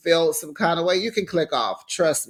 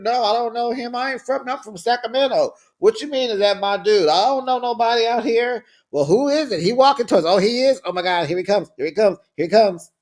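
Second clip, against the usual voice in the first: mounting alarm, pitched higher and louder toward the end; panicky back-and-forth; 5 semitones higher, 3 dB louder, faster than usual. Retold or imitated. Voicing the family spotting the stranger walking up, growing panic. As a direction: play both worried relatives talking over each other.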